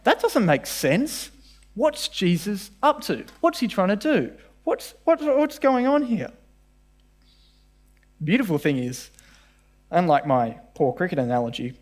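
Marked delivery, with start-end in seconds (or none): 0.0-6.3 puzzled reader. Retold or imitated. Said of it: "I went".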